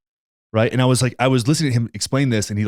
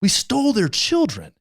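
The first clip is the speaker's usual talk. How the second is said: outraged disbelief; 11 semitones higher than usual. Real, not acted. Ranting to his cohost about stolen children, outraged disbelief. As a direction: pitch shoots way up in indignant disbelief.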